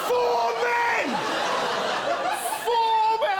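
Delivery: can't believe it, shout it; stunned exclamation; incredulous. Real, not acted. Incredulous repeat.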